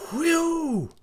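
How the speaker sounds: high, falling wind-like whoop; long, shrieking "whee-oo"; old snow woman's howl. A narrator's reading. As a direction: howl like a winter gale.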